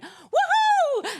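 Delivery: high, shrieking woohoo; excited whoop. Retold or imitated. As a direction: whoop like a delighted wife. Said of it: "the thing that I always did when I was very excited".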